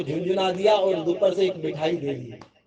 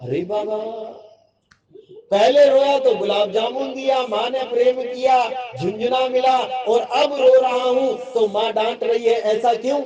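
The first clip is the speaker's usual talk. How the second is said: higher, louder whiny protest; raised childish complaint; complaining child; 5 semitones higher, 5 dB louder than usual. Acted. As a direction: argue like a wronged little boy. Child protests to scolding mother.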